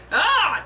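short high, loud yell; startled yell. A narrator's reading. Tony sees Hawkeye pop out, startled high yell. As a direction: cry out in shock. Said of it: "Tony yelled".

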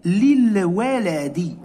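للولد drawn out slowly, li-l-wa-la-di, each syllable clear; slow model word. Shown as a demonstration. Teacher modelling للولد slowly for pupils, syllable by syllable. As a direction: spell out للولد slowly for the class. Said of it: "كيف تصبح؟".

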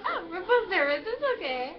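high, loud imitation of a nervous date; flustered reassurance. Vlogger quoting her blushing date, loudly flustered.

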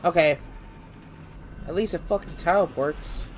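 normal talking voice

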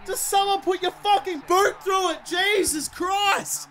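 high, wailing frustration with a pained laugh; real anguished gamer outburst; despairing yell. Real, not acted. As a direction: plead and howl as the chance slips away. Despairs as the chance is lost.

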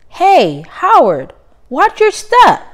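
put-on high, loud child's call; a child yelling watch out; kid friend warning. Friends warn Howard at lunch.

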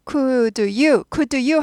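"Could you" as separate, unblended words. Shown as a demonstration. Teacher showing students what not to say, unblended. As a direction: say "Could you" stiffly, "could" and "you" kept apart. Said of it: "하지 않고".

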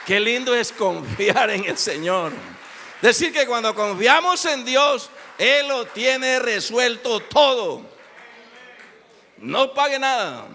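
high, loud, slow preaching; fervent preaching. Acted. Preacher exalting God's provision before the congregation, loud and slow. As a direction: proclaim it to the whole church.

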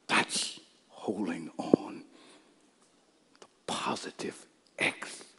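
hushed, drawn-out whisper; slow whisper. Real, not acted. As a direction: whisper it slowly to a hushed congregation. Hushed close after shouted climax.

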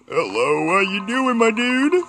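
high, loud, cheeky hello to the monster; mock friendly greeting. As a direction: greet the creature like an old buddy, teasing. Greeting a monster chasing him.

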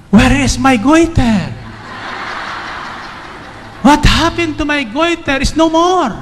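rising excited cries, pitch climbing high; exclaiming in happy disbelief. Retold or imitated. Woman marvelling at her vanished goiter, excited. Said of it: "So she was excited".

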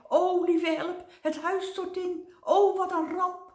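high, loud panicky cries of a timid man; terrified wail. Acted. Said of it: "bibberend van angst".

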